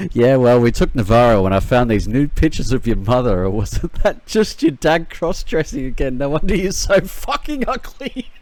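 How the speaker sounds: loud, gleeful insult over the radio; loud mocking taunt. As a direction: jeer at him, loud and cocky.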